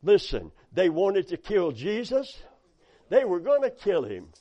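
usual speaking voice

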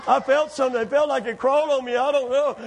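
lightly voiced scared kid; a touch of spooked boy. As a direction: gabble it like a spooked teenager. Scared boy explains after lights on.

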